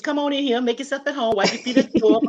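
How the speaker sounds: high, lively welcome call; excited porch-style holler to come inside; calling folks in. Pretend. Host calling viewers into the show.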